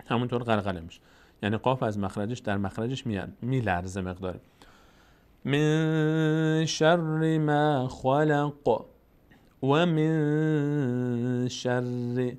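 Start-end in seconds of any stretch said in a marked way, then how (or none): 5.4-12.3 من held nasally, chanted slowly; drawn-out recitation; slow tajweed recitation. Shown as a demonstration. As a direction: stretch each word like a reciter.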